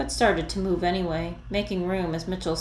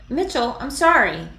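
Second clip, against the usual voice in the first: loud, higher pleading call; 7 semitones higher, 6 dB louder than usual. Acted. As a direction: call after him before the door shuts. Calling after him leaving.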